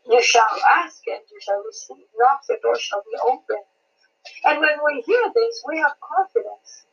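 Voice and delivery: ordinary talk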